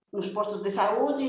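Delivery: ordinary delivery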